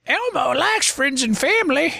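high, squeaky puppet voice; Elmo voice. Pretend. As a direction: chirp it like Elmo. Mocking the Elmo commercial.

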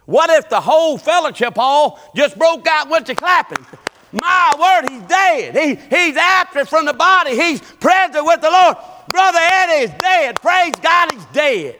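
high, loud revival preaching; full-force preaching. Acted. Preacher to congregation about a brother's death, exultant preaching.

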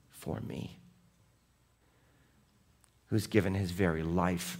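low, hushed sermon voice; hushed devotional preaching; soft reflective preaching. Acted. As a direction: speak it softly, like a quiet confession. Quiet reflective sermon close.

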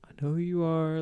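softly voiced imitation of discreet crowd control; quietly defuse it, keeping others from hearing; discreet hushing. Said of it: "he's kinda running defense here, like, crowd control".

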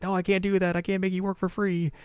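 high, anxious refusal; a touch of flustered heiress; refusing Ren. Retold or imitated.

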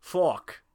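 FUCK with a drawn "aw" vowel; altered pronunciation. Pretend. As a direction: say Fuck as "fawk". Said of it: "You have to spell it weird".

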